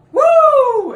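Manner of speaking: very high, loud "Woo!"; imitated crowd yell. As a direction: whoop it out like wrestling fans. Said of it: "all you hear is".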